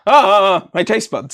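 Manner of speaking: loud wince at the sourness; pained outcry. Real, not acted. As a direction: cry out at the sour taste. Reacting to sour sweets.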